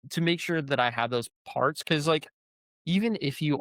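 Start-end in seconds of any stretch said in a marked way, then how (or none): none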